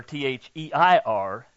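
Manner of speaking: "T H E I R" Buchstabe für Buchstabe; spelled out. Shown as a demonstration. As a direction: spell out "T H E I R" letter by letter. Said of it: "to anticipate their".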